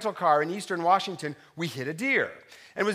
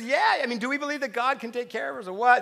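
higher, a lightly voiced wife nudging; wife asks if they trust God; wife's gentle challenge; 6 semitones higher than usual. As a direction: raise the eyebrow at him. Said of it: "she said".

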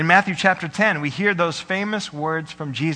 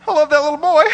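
fond burst; 11 semitones higher, 9 dB louder, faster than usual. Real, not acted. Pastor gushing to congregation about his baby son, loud and high. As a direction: blurt your love for the little one.

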